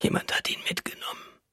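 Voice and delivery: soft, voiceless answer; weak whisper. A narrator's reading. Weak wife answers her alarmed husband, whispering. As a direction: whisper it, drained of strength.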